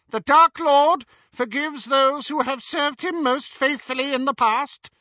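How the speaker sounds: high, loud, sneering contempt; Bellatrix's contempt. Acted. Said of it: "magnificent imitation of Bellatrix's most contemptuous manner".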